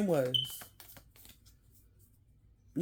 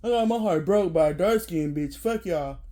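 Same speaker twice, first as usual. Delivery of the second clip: usual speaking voice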